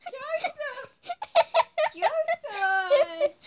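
loud laughing call; laughing shout. Real, not acted. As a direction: yell his name through laughter. Grandma calls child, laughing.

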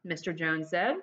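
lively read-aloud storytelling; expressive children's book reading; story narration. A narrator's reading. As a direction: tell it like reading to kids. Reads the story to the class.

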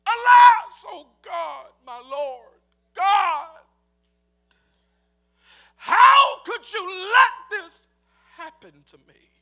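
loud anguished cry to God, slow and drawn out; desperate lament shouted skyward. Acted. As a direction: wail it up to heaven in despair. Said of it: "he began to cry out".

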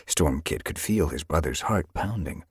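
usual speaking voice

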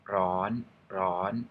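ron said high and held up; Thai high tone. Shown as a demonstration. Teacher demonstrating the word for hot, high tone. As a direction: push ron up high and level. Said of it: "common word is hot".

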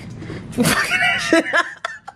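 high-pitched laugh. Real, not acted. Bursts out laughing over dinner.

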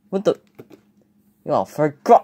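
ordinary delivery

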